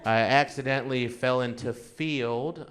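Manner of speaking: normal talking voice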